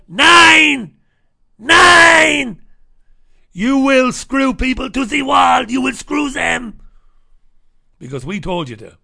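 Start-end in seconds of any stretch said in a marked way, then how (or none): 0.1-6.8 much higher, much louder shouted refusal; German bankers shouting down the Irish minister; furious mock German shouting. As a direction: scream nine like a bullying German banker.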